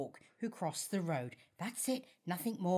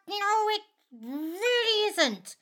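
higher, louder and slow; indignant; 11 semitones higher, 6 dB louder, slower than usual. Acted. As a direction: drag out the denial, offended. Indignant denial, quacking chicken.

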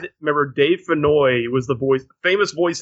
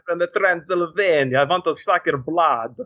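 put-on Transylvanian vampire accent. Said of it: "a Transylvanian vampire girl voice".

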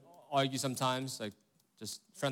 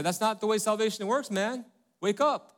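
higher, louder, scolding retort; pushing back; 8 semitones higher, 4 dB louder than usual. Retold or imitated. Pastor retells rebuking his friend, high and loud. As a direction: snap back at a naive friend. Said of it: "I push back and say".

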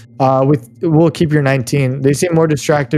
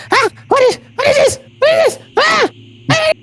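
very high, squealing goblin; panicked goblin boss. Acted. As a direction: squeal helplessly while being lifted off the ground. Goblin boss levitated against its will.

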